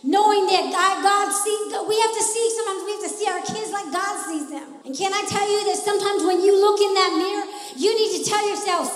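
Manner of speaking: loud, rising sermon cadence; full-force preaching. Acted. Preacher rallying the church to fight, loud preaching. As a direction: preach it out to the congregation.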